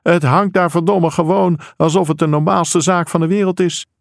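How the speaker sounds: acted angry outburst; outraged complaint. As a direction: complain in acted disgust, pitch held high. Angry father points at table.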